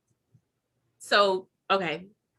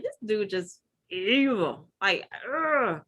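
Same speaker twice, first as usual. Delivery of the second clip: disgusted aside; slower than usual. Retold or imitated. Inner thought retold.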